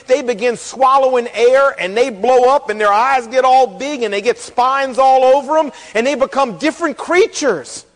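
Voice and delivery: ordinary talk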